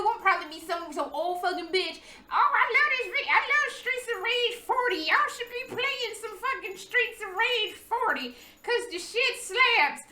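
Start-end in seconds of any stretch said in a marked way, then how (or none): 2.3-10.0 put-on old lady gushing. Imitating her future old self.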